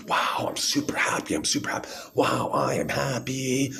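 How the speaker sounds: high, hammed-up overjoyed voice; mock manic happy. Mocking what bipolar is supposed to be like, mock manic happy. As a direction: play exaggerated elation, stretching it out at the end. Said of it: "bipolar is like".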